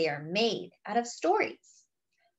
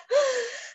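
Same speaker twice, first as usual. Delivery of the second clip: high sharp intake of breath; sharp gasp; 13 semitones higher than usual. Acted. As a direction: gasp as if holding your breath. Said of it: "Luna holds her breath".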